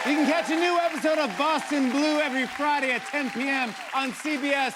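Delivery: high-pitched, projected break announcement; show host announcing. Real, not acted. Host sending audience to the break, announcer voice. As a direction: sell the show and throw to the break.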